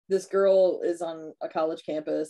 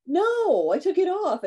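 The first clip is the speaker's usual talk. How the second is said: higher and louder, defensive; mock frat guy; 12 semitones higher, 6 dB louder, slower than usual. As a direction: squeal it like a caught dude. Frat guy answering in the story.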